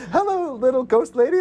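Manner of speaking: high-pitched mock hello to little things; cutesy imitated hello; sing-song greeting. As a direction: lilt up high, imitating a character's chirpy greeting. Acts character greeting a ghost.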